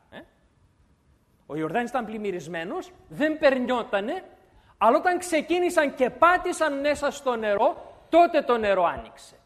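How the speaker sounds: usual speaking voice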